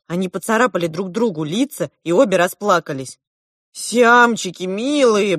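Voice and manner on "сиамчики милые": urgent pleading. A narrator's reading. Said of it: "бросилась я разнимать девчонок".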